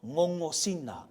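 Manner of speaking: "Ngongosina" with nasal NG, no hard G; NG as in singer. Shown as a demonstration. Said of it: "G is an N G sound, as in singer".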